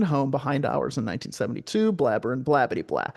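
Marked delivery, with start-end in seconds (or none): none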